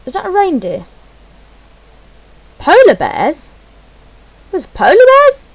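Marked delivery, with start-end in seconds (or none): 2.6-3.5 louder, rising question of surprise; a real, louder "Polar bears?"; surprised question. Real, not acted. Spots polar bears in menu. 4.5-5.5 much higher and louder squeal of delight; a real, much higher and louder squeal of joy; excited shriek. Real, not acted. Spots polar bears in menu.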